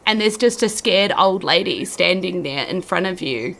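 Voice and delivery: usual speaking voice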